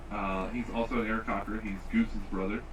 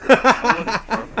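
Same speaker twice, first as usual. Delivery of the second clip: laughing; 11 semitones higher, 16 dB louder, much slower than usual. Real, not acted. Protesting through laughter.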